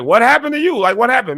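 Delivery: shocked question. Retold or imitated. Friends ask about his injury.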